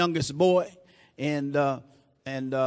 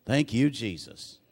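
much lower and softer, slow; soft aside; 6 semitones lower, 5 dB quieter, slower than usual. Real, not acted. Preacher thanking Jesus quietly between lines, hushed and low. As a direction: breathe it out under your breath.

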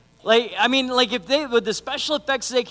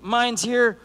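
ordinary delivery

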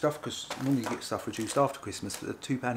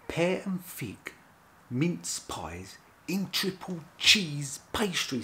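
slow reveal; 6 semitones higher, much slower than usual. Shown as a demonstration. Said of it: "reads the product name slowly".

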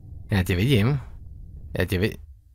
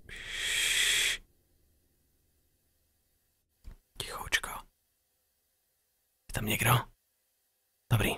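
unvoiced shushing; breathy shh and a whispered Tichoučko; whispered hush; 8 dB quieter, much slower than usual. Real, not acted. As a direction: hush yourself while hiding. Hiding, shushing himself.

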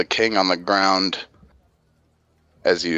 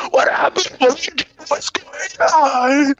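much higher, breathless pleading voice; panicked captive. Acted. Traitor held by the jaw pleads in high panic. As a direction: squeak out confused panic.

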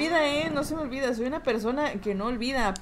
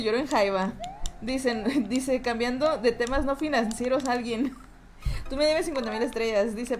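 usual speaking voice

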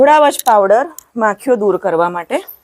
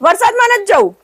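calling out; 10 semitones higher than usual. Real, not acted. Calling the cow in from rain.

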